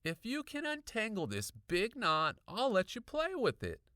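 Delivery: put-on high-pitched kid's voice making an offer; casual boy. A narrator's reading. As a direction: bargain breezily as a boy. Boy character voiced in dialogue.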